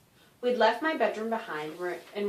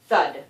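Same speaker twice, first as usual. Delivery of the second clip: heavy thud; 4 semitones lower, 9 dB louder than usual. A narrator's reading. Landing sound word.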